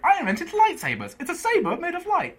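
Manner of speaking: high, mock-proud voice; lightly voiced show-off character; imitating Steve. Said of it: "he's like".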